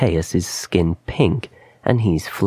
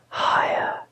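airy voiceless whisper; whispered urging in his ear; 4 dB quieter than usual. A narrator's reading. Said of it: "The whisper in his ears".

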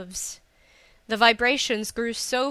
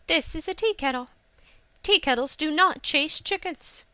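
higher-pitched, indignant correction; mildly scornful, raised pitch; haughty denial; 6 semitones higher than usual. A narrator's reading. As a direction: state it as if it were obvious. Hisa haughtily denies the accusation.

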